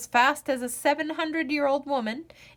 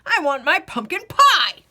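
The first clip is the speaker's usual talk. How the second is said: loud, cracked whine; old man whining for pie; 5 semitones higher, 8 dB louder than usual. Acted. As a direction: demand it like a sulky old man.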